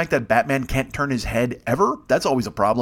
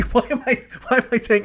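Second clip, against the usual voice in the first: laughing; 11 semitones higher than usual. Real, not acted. Catches himself lying, laughs.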